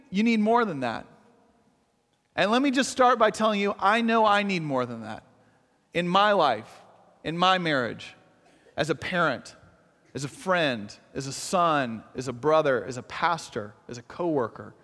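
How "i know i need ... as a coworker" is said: softening, lower confession; sincere. Real, not acted. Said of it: "confessing his own needs".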